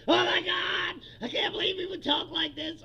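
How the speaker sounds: loud, high hammed-up indignation; mock outrage. Megas reacting to the wheelchair joke, mock horrified outrage. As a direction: wail like a scandalized supporter. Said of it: "the megas are like".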